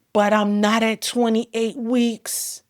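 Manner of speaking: high, loud, pleading repetition; desperate protest. Retold or imitated. Mother to doctors, desperate high loud protest. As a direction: insist desperately, begging them to wait. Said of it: "I just kept saying".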